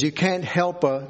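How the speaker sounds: normal talking voice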